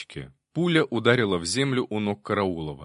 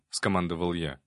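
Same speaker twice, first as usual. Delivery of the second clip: ordinary delivery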